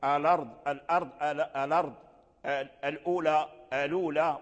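الأرض as "lard" and الأولى as "lula", the hamza dropped; Warsh transfer. Shown as a demonstration. Teacher repeats Warsh words for students, hamza dropped. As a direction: say الأرض and الأولى with the hamza vowel moved onto the lam. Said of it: "فيقول".

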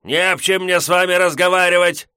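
high, loud cranky peasant snap; curt grumpy refusal. Acted. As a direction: slam the refusal shut. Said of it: "категорично отрезал хозяин".

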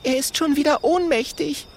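put-on high girlish voice; exasperated little girl. Mathilda voiced.

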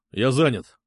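short offhand brush-off; curt. A narrator's reading. Пётр brushes off Сергей's call, curtly. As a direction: toss it out and hang up. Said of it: "коротко бросил он".

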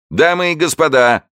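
high, loud crowing call; crowing showman. A narrator's reading. Showman crowing to the audience, high loud call. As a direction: crow it like a rooster. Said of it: "кукарекал человечек".

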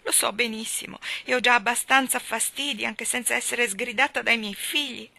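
high, loud, quavering plea; trembling voice. A narrator's reading. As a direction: let the voice tremble with shame. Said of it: "La voce della povera missis Tulliver tremava". (voce tremante e supplichevole)

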